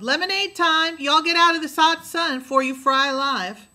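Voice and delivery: loud, high call from the door; a housekeeper calling the children in from the sun. A narrator's reading. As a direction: holler the kids in.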